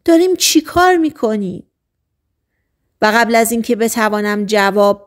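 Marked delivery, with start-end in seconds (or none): 0.0-1.6 high fast panicked cry; shrieking. Acted. Girl pushes Martin away and shrieks in panic, high and fast. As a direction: shriek it out in sudden alarm, fast. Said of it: "جیغ کشید".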